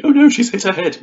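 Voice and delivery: high, loud mock panic; dramatic alarm. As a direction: gasp it out like a melodrama. Mock dramatic gasp quote.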